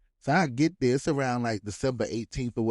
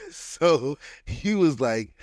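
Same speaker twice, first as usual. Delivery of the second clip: ordinary delivery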